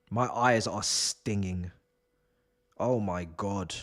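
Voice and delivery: low, subdued lament; quiet, deflated suffering; worn-out misery. Real, not acted. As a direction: sound drained by what you endured. Claims suffering after watching match.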